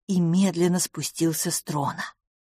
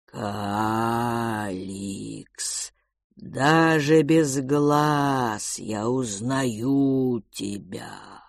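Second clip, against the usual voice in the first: lower, slow, drawn-out creature voice; slow cold whisper; 5 semitones lower, much slower than usual. Acted. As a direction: stretch each word like resin. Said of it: "Он говорил медленно и тихо, растягивая слова". (медленный холодный шёпот)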